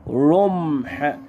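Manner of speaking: رمح very slow with a held m; sounded-out word. Shown as a demonstration. Teacher sounding out a word to analyse it, drawn out. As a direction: draw out رمح, rummm-h. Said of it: "هي كلمة إيه دي؟".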